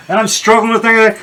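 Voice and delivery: normal talking voice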